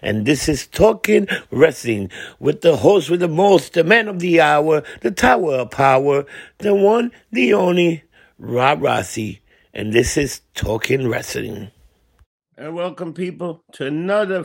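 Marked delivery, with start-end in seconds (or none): 0.0-11.7 loud, booming ring announcer intro; wrestling announcer. Acted. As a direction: hype up the crowd like a ring announcer.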